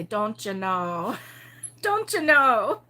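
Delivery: slow, drawn-out Upper Midwest "Don't you know?"; joking Michigan accent. Retold or imitated. Joking with people, in a slow mock Michigan accent. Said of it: "I would joke and say".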